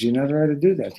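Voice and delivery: high, loud acted protest; insistent objection. Young rabbi to the drinkers, loudly objecting. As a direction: forbid it urgently.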